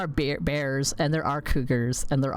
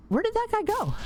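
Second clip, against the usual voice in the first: surprised. Real, not acted. Surprised at vanished companion.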